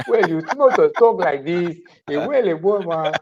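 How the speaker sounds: loud, playful Nigerian accent impression; mock Nigerian accent. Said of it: "she starts doing a Nigerian accent".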